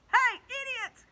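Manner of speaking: very high, loud taunting shout; yelling insults. Acted. Centipede jeering at the cloudmen, loud high yell. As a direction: holler across the sky. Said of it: "he yelled".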